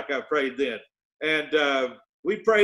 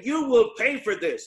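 raised, higher-pitched angry threat, short of screaming; mild mock scream; 5 semitones higher than usual. Angry opponent threatens a man in a meeting, mild mock scream. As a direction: imitate an angry man's threat. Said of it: "he was screaming at this guy".

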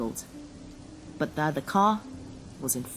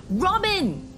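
high, loud cry of her name; shouting a name; 5 dB louder than usual. Acted. Man shouting his wife's name at the crash. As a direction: shout over him, desperate. Desperate calling out.

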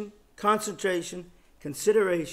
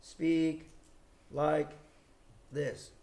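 exaggerated slow speech; 6 semitones lower, 10 dB quieter, much slower than usual. Shown as a demonstration. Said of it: "slow to speak does not mean that I".

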